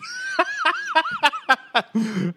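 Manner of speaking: high, loud shrieking laugh; uncontrolled squealing laughter; laughing hard. Real, not acted. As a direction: burst into a long high-pitched cackle. Cracks up at own joke.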